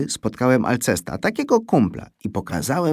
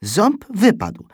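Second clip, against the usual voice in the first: plain reading voice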